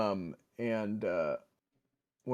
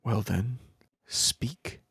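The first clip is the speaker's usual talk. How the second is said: commanding priestess; much slower than usual. Acted. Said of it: "She says".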